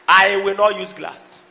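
normal talking voice

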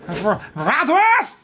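hammy mock German accent. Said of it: "offend somebody over in Germany with that, uh, accent".

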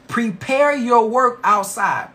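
slow, deliberate scripture reading. Shown as a demonstration. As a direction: read each word slowly and clearly. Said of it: "I'm gonna read that one more time slowly".

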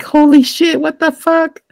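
shocked squeal at the goop monster. Retold or imitated. San and Ashitaka in the water, shocked. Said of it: "just being like".

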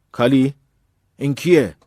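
loud, high interrogation; sharp demand. A narrator's reading. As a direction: demand an answer from her. Angry brother demands who boy is.

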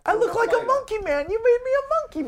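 squeaky high goofy voice; high silly voice. Acted. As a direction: pitch way up in mock dismay. Joking about ape makeup.

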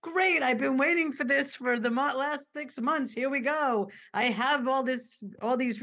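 high, eager gush of a family member; mock the over-keen relative waving a list; hammed-up eager parent; overeager excitement.

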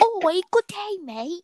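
high, hammed-up British gentleman voice; mock British accent. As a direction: play a cartoonish British chap. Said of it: "trying to do a British accent".

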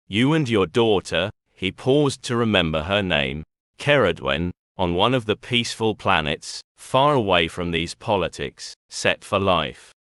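usual speaking voice

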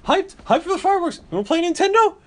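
put-on very high-pitched excited kid; Brian. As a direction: imitate a hyped-up boy, squeaking high. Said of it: "and Brian's like".

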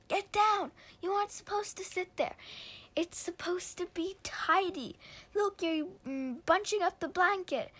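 shrill-free scolding, get her down now; fussy shout. Acted. Boy scolding his sister off his bed, annoyed high shout.

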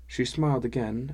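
ordinary delivery, nothing marked

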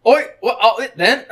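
high, loud hammed-up Cockney; Cockney British. Shown as a demonstration. Demonstrating for co-host, exaggerated Cockney British. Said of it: "you wanna hear Cockney British?".

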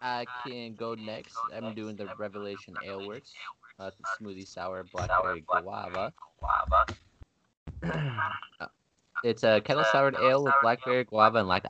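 doubled echo on the mic. Real, not acted. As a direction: voice echoing back on the line. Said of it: "I can hear myself twice".

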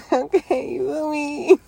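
high laughing okay; laughing hard, voice jumping up. Real, not acted. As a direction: cracks up and asks you feel me. Cracking up at himself.

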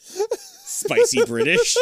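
very high, slow wheezing laugh words; laughing squeal. Real, not acted. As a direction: squeal it while crying with laughter. Laughing at the joke.

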